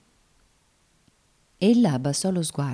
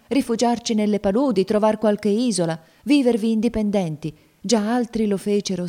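higher, louder pleading argument; urgent persuasion; 6 semitones higher, 5 dB louder than usual. Acted. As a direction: urge him to join the escape. Woman urges Tom towards freedom.

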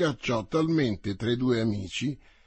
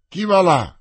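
loud, higher challenge from the patrol; guard's challenge; 5 semitones higher, 10 dB louder than usual. Acted. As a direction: call out to stop the coach. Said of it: "disse il capo". (intimazione ad alta voce)

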